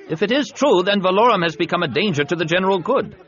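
high, loud, hurried senator voice; alarmed senator. Acted.

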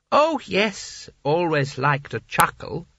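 high "oh, yes" then slow and fond; a doting grandson reminiscing; fond sultan. Acted. Creosote fondly recalls grandad.

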